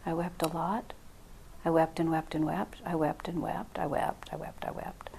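hushed grief. Real, not acted. Recalling her heartbreak, fading to a whisper. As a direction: let the memory of weeping drain the voice. Recounting grief after loss.

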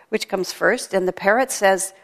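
usual speaking voice

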